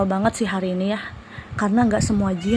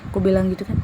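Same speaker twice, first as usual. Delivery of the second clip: ordinary talk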